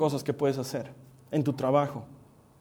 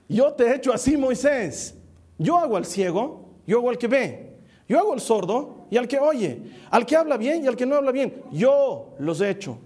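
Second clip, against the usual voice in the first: emphatic, higher-pitched declaration of authority; God; 5 semitones higher than usual. Acted. God rebuking a doubtful prophet, emphatic and commanding. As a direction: hammer out each claim, lifting the pitch. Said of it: "Dios le dice".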